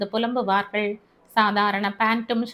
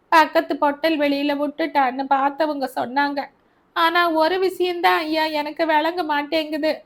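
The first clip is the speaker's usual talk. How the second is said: put-on grieving old mother; 5 semitones higher than usual. Mother lamenting her dead son to Albert, higher and aggrieved. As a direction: wail at God over her son's fate.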